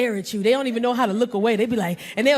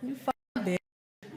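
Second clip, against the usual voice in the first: muttered daughter insult; 3 semitones lower, 12 dB quieter, much slower than usual. Retold or imitated. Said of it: "we just be like".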